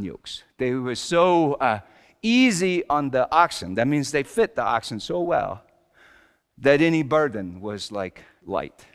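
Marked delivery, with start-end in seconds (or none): none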